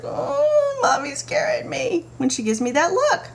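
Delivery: put-on high, wide-eyed child voice; scared little kid. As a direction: play a spooked small child. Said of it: "for your kids to be like".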